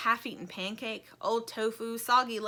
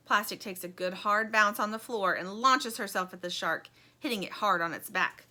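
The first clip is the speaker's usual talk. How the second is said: ordinary delivery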